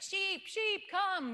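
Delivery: high, loud mock call to the flock; calling sheep. Tourists calling sheep at the pasture, high loud call. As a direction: sing out to sheep that won't budge.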